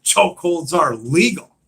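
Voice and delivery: mock-angry retort, high and loud; angry protest. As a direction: protest loudly, imitating an angry man. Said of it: "Daryl just sort of gets angry with him".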